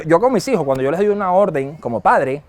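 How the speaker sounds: usual speaking voice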